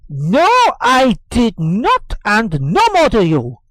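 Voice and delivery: firm denial. Acted.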